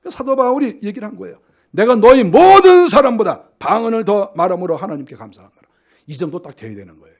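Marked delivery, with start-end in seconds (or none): 1.7-5.6 loud ringing proclamation of the verse; proclaimed verse. Acted. Preacher declaring Paul's words to the congregation, loud and ringing. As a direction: declare it to the whole church, full voice.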